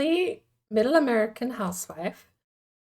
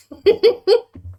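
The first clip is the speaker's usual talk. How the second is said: squealing laugh at own attempt; high burst of laughter; 11 semitones higher, 9 dB louder than usual. Real, not acted. Cracking up at own bad impression, loud high laugh.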